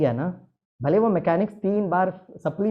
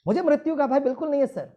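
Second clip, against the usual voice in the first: imitating a pilot's high-pitched declaration; defiant pilot; 11 semitones higher than usual. Pilot answering the speaker before the audience, emphatic mock declaration. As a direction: stand up and declare it, much higher. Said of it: "एक pilot खड़े होकर कहते".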